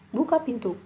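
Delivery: normal talking voice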